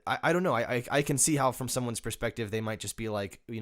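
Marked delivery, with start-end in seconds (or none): none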